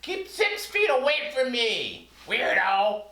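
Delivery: high squeaky puppet; cheeky fruit puppet teasing. Acted. Banana puppet talks with Factoid.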